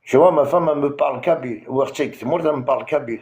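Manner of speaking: normal talking voice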